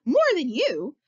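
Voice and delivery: high, loud taunt; shouting. A narrator's reading. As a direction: shout it at them in challenge. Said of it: "he shouts".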